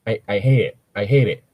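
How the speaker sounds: ordinary talk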